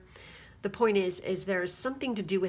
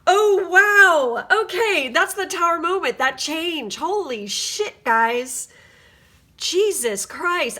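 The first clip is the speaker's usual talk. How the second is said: awed excitement; 8 semitones higher, 9 dB louder, slower than usual. Real, not acted. Awed by the card spread.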